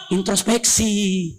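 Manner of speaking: ordinary talk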